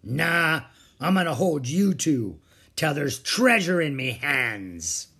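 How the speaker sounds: loud, drawling pirate menace; gloating pirate captain. Acted. Captain holding two captives till treasure comes, slow gloating. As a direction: draw it out like a villainous pirate.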